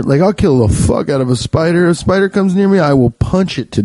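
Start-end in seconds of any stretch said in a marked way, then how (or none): none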